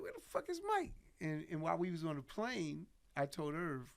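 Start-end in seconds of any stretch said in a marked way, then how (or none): 0.0-0.9 high-pitched mock exasperation; retold frustration. Guest kept waiting for the star, high mock frustration. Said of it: "So I'm getting frustrated".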